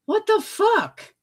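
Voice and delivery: high, slow disbelief; outraged what the; incredulous. Real, not acted. Outraged at hiding baby bump.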